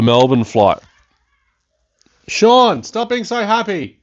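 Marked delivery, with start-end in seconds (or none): 2.3-4.0 much higher, louder call across the room; mock scolding call. Real, not acted. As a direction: call out to Sean in playful reproach. Calling to a noisy housemate.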